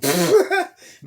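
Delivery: sudden shrieking laugh; high loud laugh. Retold or imitated. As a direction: burst of a high, loud cackle. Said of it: "Cuz started laughing like".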